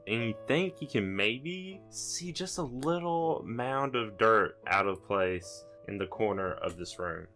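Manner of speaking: ordinary talk, nothing marked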